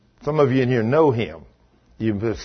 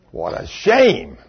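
usual speaking voice

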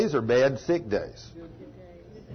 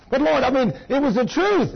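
higher, whiny protest to God; pleading excuse; 9 semitones higher than usual. Retold or imitated. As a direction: plead your case like a caught gossip.

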